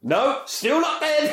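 very high, slow, squeaky voice; squeaky mock insistence. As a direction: squeal it out slowly.

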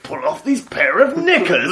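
loud, high comedy-sketch voice; hammed-up Fast Show voice; Fast Show character voice. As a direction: belt it like a sketch-show catchphrase. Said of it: "all I was hearing was".

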